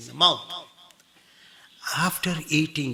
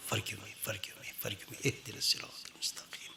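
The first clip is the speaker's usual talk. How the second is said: whispered plea; 4 semitones lower, 16 dB quieter, much faster than usual. Shown as a demonstration. Whispered plea within prayer.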